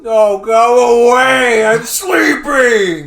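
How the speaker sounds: hammed-up half-asleep shout; mock groggy yell. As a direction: drag it out like a woken guest. Acting grumpy hotel guest.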